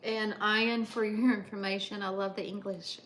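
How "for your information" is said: ordinary talk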